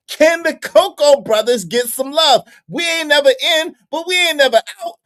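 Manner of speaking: hammed-up plea for the brown-skinned brothers; mock outrage. Real, not acted. Mock complaint about skin tone.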